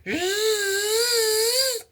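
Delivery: long high whistling wind noise; imitated howling wind. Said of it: "Have you heard wind, like, in your room".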